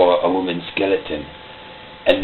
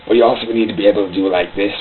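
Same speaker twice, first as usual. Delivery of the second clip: ordinary delivery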